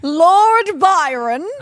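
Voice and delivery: slow, loud grand announcement; dramatic reveal. Acted. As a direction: unveil each name slowly.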